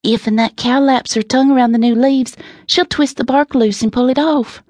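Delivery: put-on high, animated little country girl; urgent Southern child, pitched well up; lively. A narrator's reading. Essie urging her pa to stop the cow, lively. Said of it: "went on in a more lively tone".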